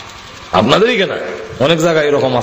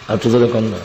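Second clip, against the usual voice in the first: ordinary talk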